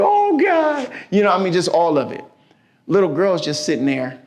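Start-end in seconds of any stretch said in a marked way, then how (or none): 0.0-0.9 high, frightened "Oh, God" in a retold panic; mock terrified cry. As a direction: imitate a terrified passenger calling on God. Terrified woman on bumpy flight.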